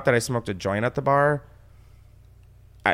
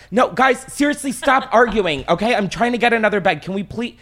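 put-on higher, louder scolding of arguing kids; put-on pleading telling-off; acted exasperated parent; 9 semitones higher, 4 dB louder than usual. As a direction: act like a harried parent mid-phone-call. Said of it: "doing this whole charade".

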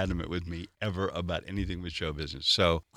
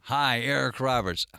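slow, higher radio intro; station ID read; 4 semitones higher than usual. Shown as a demonstration. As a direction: announce your name slowly like a station ID. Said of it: "One more time".

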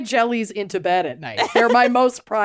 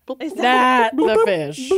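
slow drawn-out; much slower than usual. Retold or imitated. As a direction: stretch each word of "Not the fish" slowly, rising on "the". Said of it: "Oh my God".

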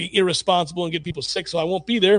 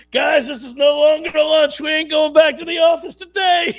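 laughing mock rally cry; 8 semitones higher than usual. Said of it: "where it starts off innocent enough".

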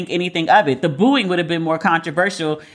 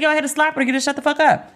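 mock casual friend; 6 semitones higher, much faster than usual. Imitating friend justifying the slap.

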